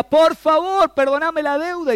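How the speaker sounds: high, loud begging cry; a servant pleading loudly with the king; desperate plea. Acted. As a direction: beg for mercy as if on your knees. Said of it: "cuando leemos la Biblia".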